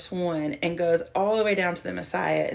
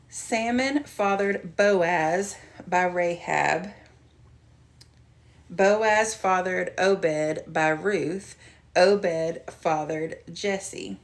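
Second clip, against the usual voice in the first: slow, careful, louder Bible reading; scripture reading; 7 dB louder, much slower than usual. Shown as a demonstration. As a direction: read each name slowly and clearly aloud. Reads Bible verses aloud.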